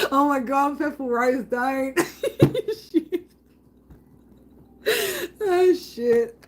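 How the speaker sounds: high, laughing through the words; can barely get the words out for laughing; giggling fit. Real, not acted. Streamer cracking up again reading a viewer's message, giggling.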